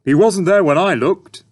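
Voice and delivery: high, loud protesting dad voice. A narrator's reading. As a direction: defend yourself, indignant. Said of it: "protested Dad".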